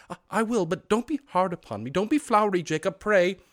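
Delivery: high, stammering plea; frightened pleading. Acted. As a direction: beg the ghost nervously. Scrooge pleads.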